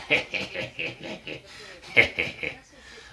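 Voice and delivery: a long mocking laugh in a put-on voice; imitated laugh. Imitating Raul laughing at English fans, mocking laugh. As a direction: laugh it off like an amused executive. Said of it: "he laughed and he went".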